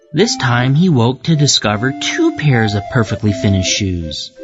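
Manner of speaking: usual speaking voice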